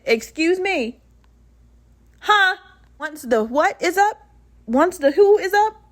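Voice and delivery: high, indignant questions, escalating; incredulous. Retold or imitated. Patient hearing she must stay a week, incredulous.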